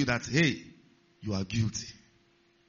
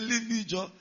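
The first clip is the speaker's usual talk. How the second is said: higher-pitched acted brush-off; mock upset rebuff; 6 semitones higher, 4 dB quieter than usual. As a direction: play a distressed patient pushing someone away. Acted crying patient rebuffs visitor.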